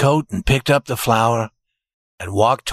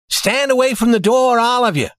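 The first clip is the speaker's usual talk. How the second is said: higher, firm order to step back; command; 10 semitones higher than usual. A narrator's reading. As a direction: take charge of the room. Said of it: "he commanded".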